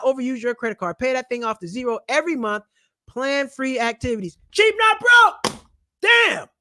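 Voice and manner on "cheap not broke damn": slow, high, loud drawn-out slogan; the channel name yelled like a battle cry; shouted catchphrase. Real, not acted. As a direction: stretch and holler the motto.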